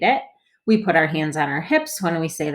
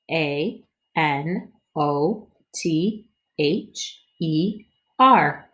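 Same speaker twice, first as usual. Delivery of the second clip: letters spaced out slowly; slow letter spelling; much slower than usual. Shown as a demonstration. Teacher leads body spelling for kids. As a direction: spell each letter deliberately. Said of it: "spell 'em with our body and with our voice".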